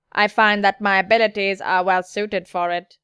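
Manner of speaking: steady synthetic-sounding delivery; android voice. Acted. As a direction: state it like a machine. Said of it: "because my voice is too steady".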